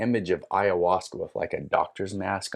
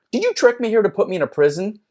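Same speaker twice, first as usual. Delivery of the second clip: high, loud, fast outrage; indignant accusation; 12 semitones higher, 7 dB louder, faster than usual. Retold or imitated. Trapped seeker accusing the spirit of trickery, indignant. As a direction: accuse in a raised, rushing voice.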